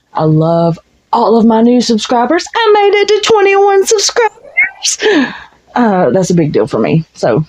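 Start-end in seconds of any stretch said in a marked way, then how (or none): 2.5-5.0 much higher, bursting with excitement; excited squeal. Real, not acted. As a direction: squeal it like big news. Excited about subscriber count.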